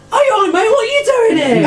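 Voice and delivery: friendly charmed captain. Acted. GM plays the charmed bandit captain turning suddenly friendly. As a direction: snap from menace to matey cheer. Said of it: "he goes from being really aggressive to going".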